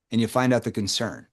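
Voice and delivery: ordinary talk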